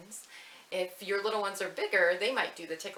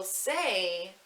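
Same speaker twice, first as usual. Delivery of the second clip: slowly; 4 dB louder, much slower than usual. Shown as a demonstration. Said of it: "say the slowly snail part slowly".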